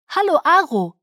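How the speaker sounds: clear, bell-like and polite; high ringing child voice. Acted. Renesmee greets Aro formally in a high ringing child's voice. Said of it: "mit ihrer hohen, klingenden Stimme".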